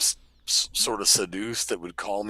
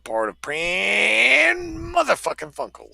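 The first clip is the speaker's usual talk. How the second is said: hammed-up sign-off; 9 semitones higher than usual. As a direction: belt out the show's name like a host. Hammed-up show sign-off to listeners.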